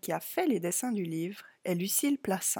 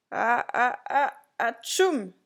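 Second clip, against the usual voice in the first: building "ah" into loud sneeze; acted sneeze; 3 semitones higher, 4 dB louder, slower than usual. A narrator's reading. Clown with flu cries out, acting a sneeze. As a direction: wind up slowly to a big fake sneeze. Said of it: "s'écria-t-il".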